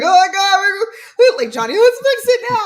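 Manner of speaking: high, loud rush to fix it; the empath in her leaping in to fix everything; frantic empath. Retold or imitated. Counselor mocking her frantic urge to fix, high and loud. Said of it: "the empath in me wants to be like".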